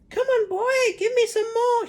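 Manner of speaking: put-on very high, loud cackling old woman; screechy crone. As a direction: cry out like a greedy hag. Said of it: "she yelled".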